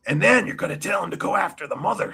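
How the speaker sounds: high, put-on boss voice; hammed-up impression, swooping upward; Vince on the phone. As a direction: build the orders toward a big punchline. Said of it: "I can just hear that phone call now".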